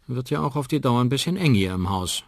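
ordinary delivery, nothing marked

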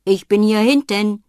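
loud call from out of sight. Acted. Old man calls to the visitors from behind, loud. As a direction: call them round the back.

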